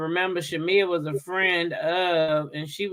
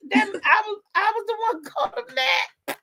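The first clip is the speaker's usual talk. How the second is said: high-pitched mock dismay breaking into laughter; retold startled realization; 12 semitones higher, 4 dB quieter than usual. Telling co-hosts how she found out she was blocked, high amused shock. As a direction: replay your shock, much higher, laughing by the end.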